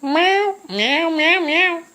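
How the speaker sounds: string of high mock meows; cat meows. The kitty replying to the sandwich question, high meows. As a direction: meow meow like a chatty cat.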